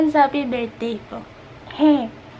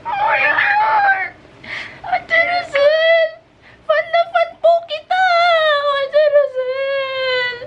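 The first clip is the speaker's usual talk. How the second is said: high, slow near-crying squeals; screaming fangirl; 11 semitones higher, slower than usual. Acted. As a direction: gush like a starstruck fan. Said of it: "fan niya ako".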